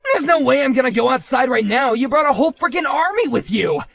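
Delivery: loud, high panicky protest; panicked yelling. Acted. Husband refusing to step outside, loud panicked shout. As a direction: shout it from behind the door.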